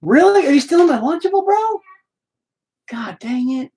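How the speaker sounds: real exasperated surprise; caught-you disbelief. Real, not acted. Catches kid in his snack.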